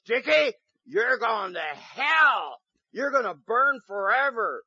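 rain down hellfire on him like an angry big sister; furious sister yelling. Retold or imitated. Redheaded sister threatening hellfire.

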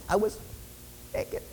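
very soft, halting squeak; tiny embarrassed admission; barely out loud. Acted. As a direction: squeak it, swallowing the last word. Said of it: "He doesn't really say it out loud".

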